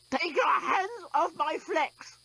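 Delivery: high, slow, crying shout; a strangled robot crying out in panic; choked cry. A narrator's reading. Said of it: "choked the despot".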